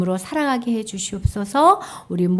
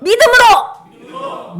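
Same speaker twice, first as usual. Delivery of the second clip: even higher, slow, loud 믿음으로; stronger rallying shout; 12 semitones higher, 6 dB louder, much slower than usual. Shown as a demonstration. Teacher repeating the motto with the children, stronger. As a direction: chant 믿음으로 stretched, higher again. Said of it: "다시 한번 꾹 참자".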